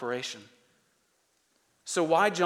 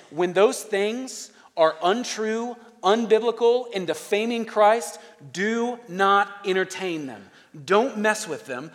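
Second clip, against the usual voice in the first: slow, higher, deliberate warning; emphatic preaching; 4 semitones higher, 4 dB louder, slower than usual. Acted. Preacher warning the church against false teachers, slow and emphatic. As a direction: lean on every phrase like a warning. Preacher drives home John's command.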